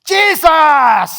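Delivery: demonstrated cry at full force; loud cry to God. Shown as a demonstration. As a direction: shout it out as a desperate prayer. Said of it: "berteriaklah dengan nyaring".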